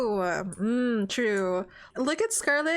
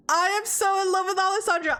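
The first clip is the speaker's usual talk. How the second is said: high, fast, gushing declaration of love; gushing confession; 7 semitones higher, 3 dB louder, faster than usual. Acted. Scarlet confessing her love for Alessandro, gushing. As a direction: blurt it out, pitched up and quick. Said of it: "we can say that's in her head".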